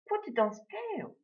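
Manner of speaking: high-pitched lady giving an order; high, commanding lady's voice; imperious lady customer. A narrator's reading. Acted lady orders the butcher.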